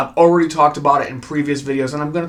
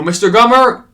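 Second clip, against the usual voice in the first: louder, higher mock call of the name; 7 semitones higher, 8 dB louder than usual. Survivor shouting for Burt at the cliff, raised. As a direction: call the name out urgently, twice.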